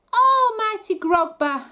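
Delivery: high, loud, slow gushing flattery; slow, loud nervous gush; blurted out. Acted. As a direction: burst out fawning at the prince. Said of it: "Mum blurted out".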